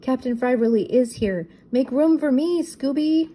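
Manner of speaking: high, panicky rushed voice; scared Shaggy. A narrator's reading. Shaggy begging Scooby for room under the chair, scared. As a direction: squeak it out in a panic.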